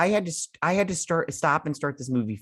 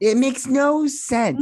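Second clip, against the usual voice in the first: exasperated; 12 semitones higher, 6 dB louder, slower than usual. Real, not acted. Echoing disbelief about the plot.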